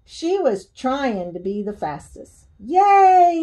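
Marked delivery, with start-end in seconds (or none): 2.6-3.4 high, loud, drawn-out cheer; yelling crowd. A narrator's reading. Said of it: "yelled the crowd".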